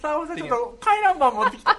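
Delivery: chatty neighbour voice, half laughing; neighbour dropping by. Acted. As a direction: call in cheerily with the circulating notice. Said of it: "坂本さんってなんか隣の".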